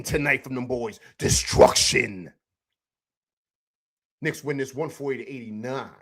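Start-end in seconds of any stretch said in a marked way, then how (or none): 1.2-2.3 Destruction stretched out slow and low; drawn-out emphasis. Shown as a demonstration. Host savoring the night's word for viewers, drawn out slowly. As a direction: let Destruction hang, slow and heavy. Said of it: "repeats the night's word alone".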